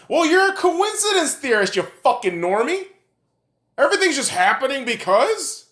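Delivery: high, heated comeback; indignant retort. Real, not acted. Ranter hits back at someone calling him conspiracy theorist, indignant retort. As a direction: throw it back at them, pitch jumping up.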